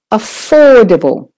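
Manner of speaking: "affordable" with a long, stressed OR. Shown as a demonstration. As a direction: hit the or in "affordable" and relax the rest. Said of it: "hear how to stress".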